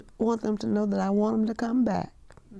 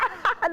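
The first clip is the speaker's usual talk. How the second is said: happy laugh; 11 semitones higher than usual. Real, not acted. Owner laughs at compliment.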